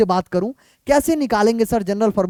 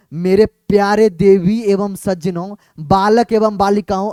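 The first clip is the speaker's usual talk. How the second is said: mock formal address; 4 semitones lower, slower than usual.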